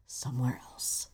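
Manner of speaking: breathy whisper to close; whispered aside. Real, not acted. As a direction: drop to a whisper on the end. Pointed aside to the jerks.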